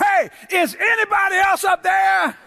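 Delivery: high-pitched holler for someone else; desperate shout. Acted. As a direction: yell upward for any other help. Man desperately calls past God's answer.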